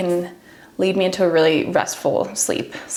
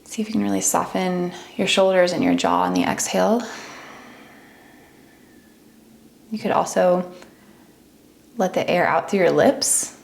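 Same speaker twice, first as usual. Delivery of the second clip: unhurried meditation voice with long pauses; guided meditation. Acted. Teacher talks listeners into relaxing each body part, guided meditation. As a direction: ease them gently through a body scan, leaving space between phrases.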